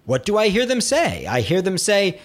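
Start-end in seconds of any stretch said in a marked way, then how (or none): none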